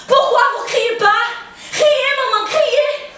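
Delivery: loud, high shouted demand; frantic urging. Acted. Daughter urging her mother to scream, loud and frantic. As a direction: shout at her to join in, desperately.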